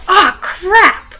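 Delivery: loud, high mock exasperated yelp; frustrated outburst. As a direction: blurt it out like you just dropped something. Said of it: "I'm just like".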